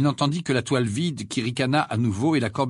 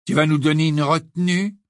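louder, higher mocking challenge; malicious taunt; 5 semitones higher, 5 dB louder than usual. A narrator's reading. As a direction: goad him with a nasty grin. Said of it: "un sourire malveillant".

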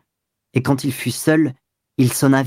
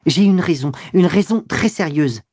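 higher-pitched, urgent begging; insistent pleading; 5 semitones higher than usual. A narrator's reading. Lover insisting that she stay in, pleading. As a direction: press the plea earnestly. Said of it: "Il insista".

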